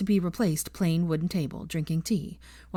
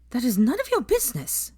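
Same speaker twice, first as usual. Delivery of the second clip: higher, sharp snap; snapped; 7 semitones higher than usual. A narrator's reading. Hermione snapping at Pansy over the letter, sharp. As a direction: bite the words off, embarrassed. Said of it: "snapped Hermione".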